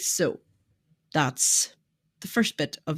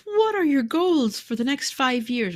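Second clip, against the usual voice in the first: higher, slow, drawn-out mock question; imitated questioner; 9 semitones higher, 3 dB louder than usual. People asking a business owner about goals, imitated questioner. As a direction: lightly mimic someone pressing for long-term plans.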